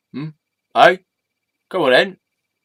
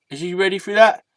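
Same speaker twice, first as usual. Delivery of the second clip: ordinary delivery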